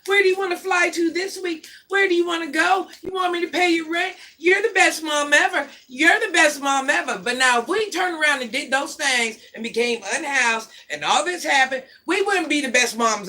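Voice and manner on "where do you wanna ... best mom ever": mock gushing fans. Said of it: "everybody's like".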